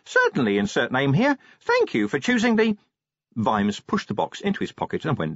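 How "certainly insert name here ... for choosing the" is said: imp voice. Acted. Imp replies.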